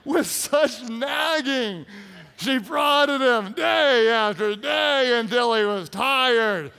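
loud, slow, stretched shout; hammered-out preaching. Real, not acted. Preacher rereading the nagging verse, loud and slow. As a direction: relish every drawn-out word.

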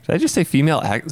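ordinary talk, nothing marked

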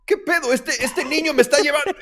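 high, loud startled protest with a laugh; sudden high-pitched outrage; shocked disbelief. Retold or imitated. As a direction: blurt it out in disbelief, half laughing. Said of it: "Y yo".

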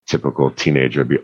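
ordinary delivery

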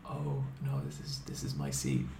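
very low, hushed meek voice; barely audible, nervous excuse; meek reply. Retold or imitated. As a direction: shrink into a timid murmur. Timid answer to intimidating stranger.

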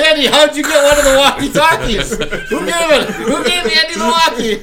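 giggling high mock official; mock replay official. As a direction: squeak it out as a baffled official. Voicing New York replay official.

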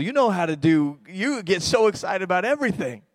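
rally the congregation, rising; fiery sermon push; preaching at full force. Acted.

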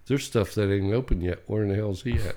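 muttering coworker. Retold or imitated. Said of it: "'cause he'd say".

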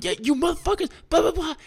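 high-pitched mock yell at the other drivers; retold furious yelling. Retelling road rage, yelling at the drivers in a high mock yell. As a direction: yell it like you've lost it.

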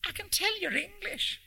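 put-on very high North Country old lady; North Country accent. A narrator's reading. Old lady addressing a stranger on the ferry, high North Country accent. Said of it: "she said in an English North Country accent".

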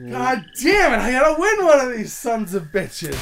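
loud frustrated cursing; frustrated outburst. Real, not acted. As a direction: vent the frustration loudly. Lost rock paper scissors.

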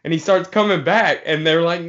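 ordinary talk, nothing marked

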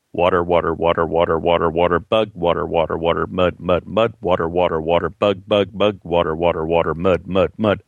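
low, monotone crawfish chant; deadpan low recitation of a repetitive story; mock excerpt reading. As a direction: drone the words in a low, even, rhythmic stream. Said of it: "gonna read you an excerpt".

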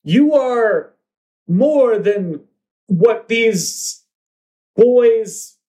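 high, drawn-out proclamation without much volume; mock yell. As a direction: declaim it as a broadcast to the crowd. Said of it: "the question yells".

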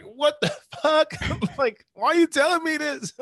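high, loud, laughing outrage; retold dismay cracking into laughter; incredulous. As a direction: protest in comic disbelief. Retells dismay at professor's story.

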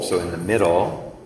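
ordinary talk, nothing marked

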